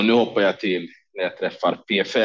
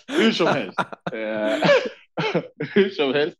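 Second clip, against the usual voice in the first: laughing it off; 9 semitones higher, slower than usual. Real, not acted. Laughing off the story.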